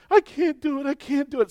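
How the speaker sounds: put-on high-pitched whimpering child voice; whiny exhausted kid. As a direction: mimic a spent runner begging to stop. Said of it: "exhausted kid pleading".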